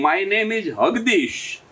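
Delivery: "હગદીશ" said with h instead of j, slowly; slow English, "હગદીશ" with breathy h. Retold or imitated. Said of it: "hoax".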